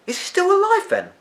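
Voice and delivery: surprised. A narrator's reading. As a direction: let the surprise lift it. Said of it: "Charlie was surprised".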